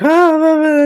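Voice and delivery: mock high squealing ah. Steph running in with a high mock squeal. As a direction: squeal high like she bursts in.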